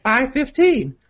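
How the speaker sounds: high-pitched put-on girlfriend voice; hammed-up girly texting voice. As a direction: read it in a mock-girlish falsetto. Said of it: "a girly voice for this part".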